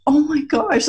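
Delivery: high thrilled exclamation; light up like a grandpa-to-be; dad thrilled about two grandsons, high and delighted. Retold or imitated. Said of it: "he was like".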